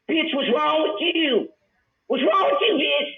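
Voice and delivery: rising outraged rant. Real, not acted. Ranting about Carrie Ma.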